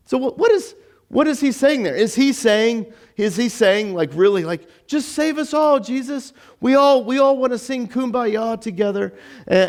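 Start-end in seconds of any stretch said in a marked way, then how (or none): 4.9-9.7 high-pitched parody of togetherness; hammed-up, higher-pitched appeal; mock pious plea. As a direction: plead with exaggerated hopefulness.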